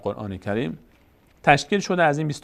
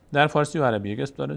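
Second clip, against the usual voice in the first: ordinary talk, nothing marked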